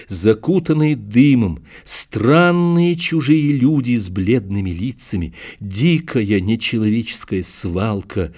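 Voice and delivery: plain reading voice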